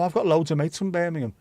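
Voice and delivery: usual speaking voice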